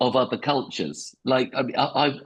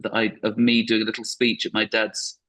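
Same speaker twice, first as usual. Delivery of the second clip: ordinary talk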